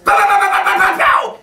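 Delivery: high, explosive imitated blasts; full-volume vocal sound effects; shouted rapid gunfire noises. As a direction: fire off loud cartoon pows. Re-enacts his loud noises at microphone.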